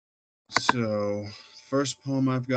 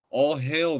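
raised, higher call of homage; declaimed cry; 5 semitones higher, 5 dB louder than usual. A narrator's reading. Poet voicing the crowd's refrain, mildly declaimed cry. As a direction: call it out as a measured chant. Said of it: "they cried".

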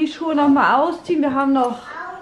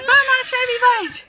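put-on child's call; 9 semitones higher than usual. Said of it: "Sie ruft".

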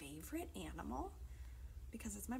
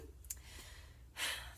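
long breathy exhale; sigh; 8 dB quieter than usual. Real, not acted. Leader winding down after the songs, long sigh.

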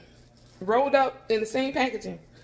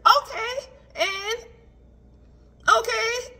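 stunned okay; 11 semitones higher, 6 dB louder, much slower than usual. Retold or imitated. Retold shock at the price.

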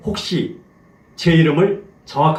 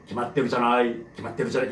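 기맛대로잖아요 mumbled lower and much softer, fading out; mumbled wrong take; 6 semitones lower, 8 dB quieter, faster than usual. Shown as a demonstration. Teacher demoing a mumbled 기맛대로잖아요 to avoid. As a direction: swallow 기맛대로잖아요 to show what not to do. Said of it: "이렇게 하면 안 돼".

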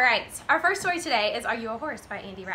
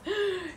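quick high gasp; sharp intake of breath; startled gasp; 7 semitones higher, 6 dB quieter than usual. Acted. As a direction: gasp at a big beast. Cowboy sees something ferocious.

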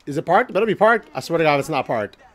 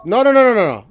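frantic protest; 8 semitones higher, 6 dB louder, faster than usual. Real, not acted. Player reacts to game failure.

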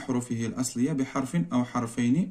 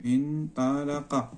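each sound drawn out slowly; انطلق spelled out very slowly for pupils to copy; slow dictation; 6 dB quieter, much slower than usual. Shown as a demonstration. Teacher dictating the verb to pupils, very slow. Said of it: "انطلق فعل مزيد".

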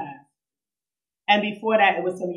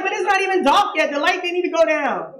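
put-on much higher and a bit louder, a whiny young girl; childish protest; 10 semitones higher, 4 dB louder than usual. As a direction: insist like a kid who wants to stay out. Her younger self protesting.

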